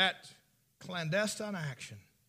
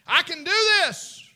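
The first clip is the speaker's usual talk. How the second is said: mock daredevil boast; 11 semitones higher, 13 dB louder, slower than usual. Mocking a reckless daredevil.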